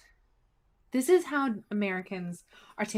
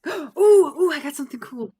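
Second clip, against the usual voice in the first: high, loud excited ooh; thrilled by a find; 6 semitones higher, 12 dB louder than usual. Real, not acted. Finds something cool in game.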